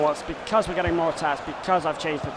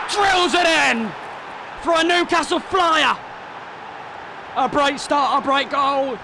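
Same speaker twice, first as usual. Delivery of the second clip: higher, louder commentator roar; goal celebration shout; 9 semitones higher, 4 dB louder than usual. Acted. Gamer calling his own goal, excited commentator shout. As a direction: erupt as the ball hits the net.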